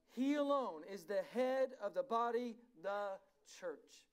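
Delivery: slow, forceful scripture declamation; emphatic preaching. Real, not acted. Preacher reads verses on Jesus to the church, slow and emphatic. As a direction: proclaim it phrase by phrase, with weighty pauses.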